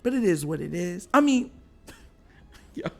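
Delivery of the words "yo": very high, slow, squeaky laugh-crying words; words squeezed out through helpless laughter; laughing hard. Real, not acted. Laughing at the Bengals, high and slow through tears. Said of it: "I can't stop laughing about it".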